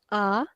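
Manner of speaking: A as a natural open "ah"; open default A. Shown as a demonstration. Teacher summing up the default sound, open ah for A. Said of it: "Natural or the default sound".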